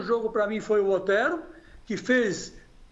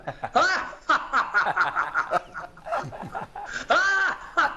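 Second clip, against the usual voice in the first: evil laugh. Pretend. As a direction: cackle like a cartoon villain. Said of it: "eu quero dar a minha gargalhada maligna". (gargalhada maligna)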